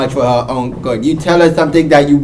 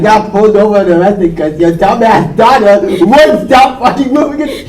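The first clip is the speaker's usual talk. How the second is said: rising exasperated rant; 8 semitones higher, slower than usual. Retold or imitated. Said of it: "if I were there".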